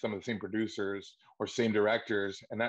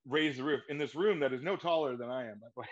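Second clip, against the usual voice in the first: ordinary talk, nothing marked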